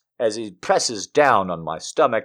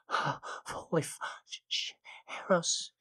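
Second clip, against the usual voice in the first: breathless gasp; 5 semitones higher, 18 dB quieter than usual. A narrator's reading. Narrator overwhelmed by his touch, breathless broken gasps.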